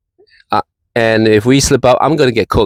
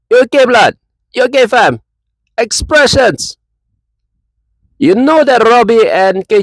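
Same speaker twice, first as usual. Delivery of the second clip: Jamaican-style banter voice, pitched up and teasing; mock Jamaican accent; 2 semitones higher than usual. Vlogger taunting a rival fan in a mock Jamaican accent. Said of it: "I don't sound Jamaican".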